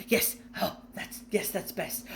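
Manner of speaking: quiet breathy muttering; muttering to himself. A narrator's reading.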